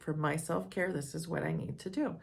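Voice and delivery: ordinary talk, nothing marked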